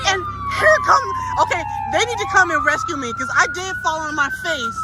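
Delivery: very high, frantic pleading; panicked screaming. Real, not acted. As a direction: squeal for someone to rescue you. Fell, wants rescue.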